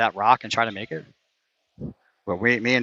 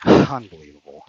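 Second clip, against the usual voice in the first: frustrated sigh; 8 semitones lower, 7 dB louder than usual. Real, not acted. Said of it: "I'm so mad right now".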